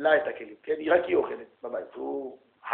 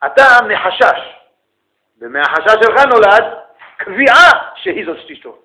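louder, higher, slow preaching rebuke; rising rebuke; 6 semitones higher, 13 dB louder than usual. Real, not acted. Rabbi rebukes student over dishes, loud and slow. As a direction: hammer each word slowly. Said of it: "rabbi rebukes, voice rises".

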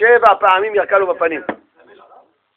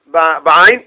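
ordinary delivery

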